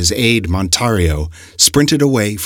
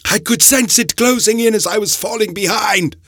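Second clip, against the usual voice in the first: labored breathing. A narrator's reading. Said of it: "his breathing labored".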